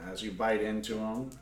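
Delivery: normal talking voice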